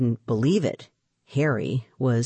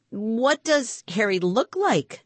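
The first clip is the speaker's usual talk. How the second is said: high, slow, haughty question; demanding; 7 semitones higher, slower than usual. A narrator's reading. Woman at the window questions the children, demanding. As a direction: demand it word by word. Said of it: "demanded the woman".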